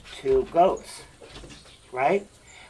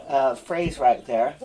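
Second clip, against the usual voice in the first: ordinary talk, nothing marked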